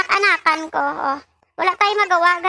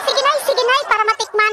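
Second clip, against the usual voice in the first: put-on cheerful kid quote; 7 semitones higher than usual. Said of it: "Sabi niya".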